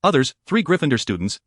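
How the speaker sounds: usual speaking voice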